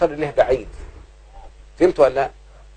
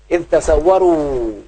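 slow, stretched verse ending; drawn-out recitation; much slower than usual. Shown as a demonstration. Teacher quoting a Quran verse to pupils, drawn-out recitation. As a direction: draw out the last vowel slowly.

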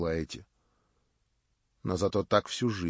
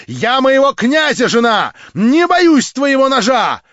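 much higher, louder, proud; Marya, defiant; 15 dB louder than usual. A narrator's reading. Marya scorns her would-be prince, high and loud. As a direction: cry it out with haughty scorn.

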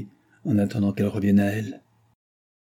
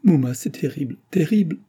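tearful cry; 7 semitones higher, 3 dB louder than usual. A narrator's reading. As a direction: voice climbing high in tears, terrible said twice. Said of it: "Gilda l'étreint et fond en larmes".

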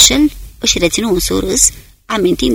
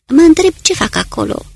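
high exclamation of frustrated wonder; exasperated outburst, pitched high; exclaiming; 9 semitones higher than usual. A narrator's reading. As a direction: blurt it out, pitched up. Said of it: "exclamă Simon".

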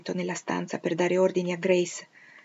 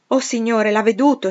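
higher and louder in sudden alarm; alarmed exclamation; 4 semitones higher, 8 dB louder than usual. A narrator's reading. Grace warning the master as the madwoman rises, alarmed. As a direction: cry out as the danger appears. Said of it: "esclamò Grace".

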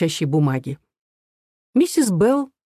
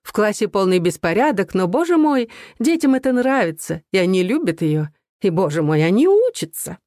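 Mrs Preston Betty's mincing voice; 3 semitones higher than usual. Retold or imitated. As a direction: gush like a prim, simpering lady. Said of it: "жеманный голос Мэкс Престон Бетти".

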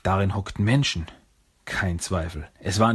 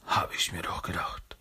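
soft unvoiced whisper; murmur; 2 semitones lower, 7 dB quieter than usual. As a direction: breathe it under your breath. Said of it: "murmelte Splinter".

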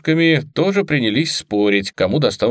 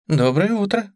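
high chirpy chirp; cartoon princess; 11 semitones higher than usual. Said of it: "прощебетала Лив".

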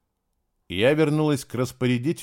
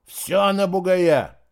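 slow, loud and higher bellow; loud drunk shout; 7 semitones higher, 6 dB louder, much slower than usual. A narrator's reading. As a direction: bellow it, tipsy and brash. Drunk guard placing bet. (громко, пьяно выкрикивая)

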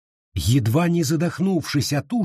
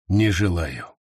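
low beast voice; 7 semitones lower than usual. Acted. Beast answers in sleep.

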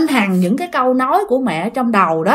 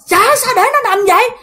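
urgent neighbor; 10 semitones higher than usual. Retold or imitated. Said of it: "mới kêu rằng".

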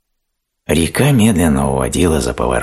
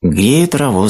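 high storybook hare voice; high-pitched, unshouted character line; hare's ordinary reply; 11 semitones higher, 4 dB louder, slower than usual. A narrator's reading. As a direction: answer as the little hare. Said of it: "сказал заяц".

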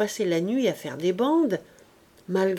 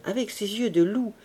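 usual speaking voice

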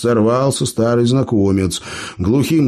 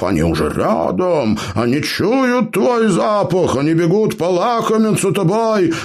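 frantic shouting; 9 semitones higher than usual. A narrator's reading. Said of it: "надрывно кричал в ухо".

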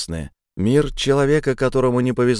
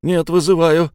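hesitant loud insistence; 7 semitones higher, 5 dB louder than usual. Acted. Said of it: "поколебавшись".

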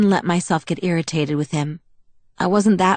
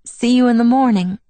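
higher, louder cheery sign-off; amused farewell; 6 semitones higher, 5 dB louder than usual. A narrator's reading. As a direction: call it out with amusement. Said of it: "Alice laughed".

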